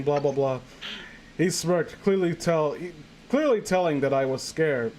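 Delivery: ordinary talk, nothing marked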